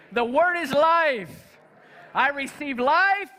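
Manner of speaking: preacher leading a congregational confession; call to repeat. Acted. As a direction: lead the church line by line, emphatic. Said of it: "I want you to repeat after me".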